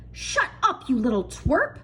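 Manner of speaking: sharp, high, spiteful aunt voice; nasty snap. A narrator's reading. Aunt Spiker cutting off James who admires the peach, nasty snap. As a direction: snap it at the boy with contempt. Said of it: "Aunt Spiker snapped".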